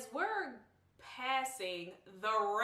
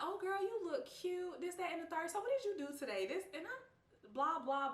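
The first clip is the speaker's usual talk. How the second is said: high, sugary fake-nice greeting; overly sweet cousin; 9 semitones higher than usual. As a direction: gush over her suspiciously. Cousin acting weird and sweet.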